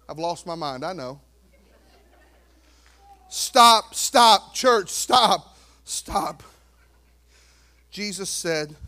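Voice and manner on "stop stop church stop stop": loud commanding calls; loud stop. Real, not acted. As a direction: halt the congregation firmly. Preacher calls church to halt.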